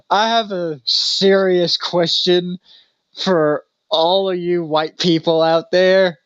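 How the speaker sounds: high, slow, pleading imitation of a crying man. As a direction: drag out a tearful desperate question. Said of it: "he is just like".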